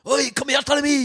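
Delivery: high, hollered rough Scottish impression; hammed-up Scottish yell; rough Scottish accent. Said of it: "really rough Scottish accent".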